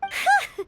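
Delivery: relieved laugh. Acted. Relieved after Cloud Retainer not mad.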